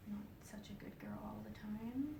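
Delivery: soft whispered judgment; whispered aside. Retold or imitated. The life review judging her, in a whisper. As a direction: whisper it like a gentle scolding.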